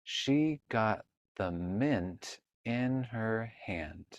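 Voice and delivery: very slow, word by word; slow reading aloud. Shown as a demonstration. Teacher modeling slow reading of a sentence for a child. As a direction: read the whole sentence like a beginner. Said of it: "Let's read the whole sentence".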